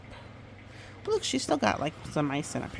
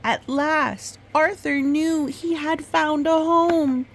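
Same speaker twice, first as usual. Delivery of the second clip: much higher and louder, triumphant; joyful ending; 11 semitones higher, 8 dB louder than usual. A narrator's reading. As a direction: announce the happy ending with delight. Happy ending of the story.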